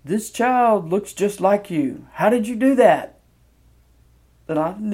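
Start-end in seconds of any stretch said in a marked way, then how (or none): 0.0-3.1 imitating a nurse, slow, raised and emphatic; big booming nurse voice. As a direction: boom it out slowly in a put-on voice. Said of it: "in this big, deep voice".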